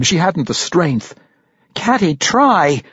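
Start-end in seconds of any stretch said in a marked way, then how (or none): 1.7-2.8 high, slow, drawn-out yell; raised, straining appeal; desperate yell. A narrator's reading. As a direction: yell it as an urgent plea. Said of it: "Regis yelled".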